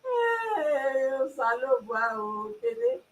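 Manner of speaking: high, soft laugh-crying calls; mock wail at Kene, high and laughing. Real, not acted. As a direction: wail the name through helpless laughter. Laughing wail at friend's tease.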